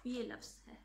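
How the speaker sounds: usual speaking voice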